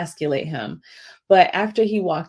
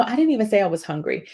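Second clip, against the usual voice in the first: usual speaking voice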